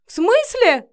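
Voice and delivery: high, loud startled question; shrieky panic; panicked. A narrator's reading. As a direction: blurt it out in fright. Terrified man retreats, wide-eyed.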